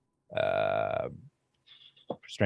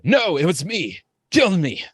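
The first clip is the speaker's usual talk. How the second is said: high, raised-voice counter-confession; yelled plea; 9 semitones higher, 6 dB louder than usual. Acted. Brown captive covering for his brother, high urgent yell. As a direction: cry out to take the blame. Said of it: "The brown one then yells out".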